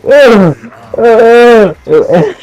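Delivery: high, pushed "ugh" of disgust; disgusted groan. Retold or imitated. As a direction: recoil with a loud retching groan. Acts out disgusted reaction to exposure.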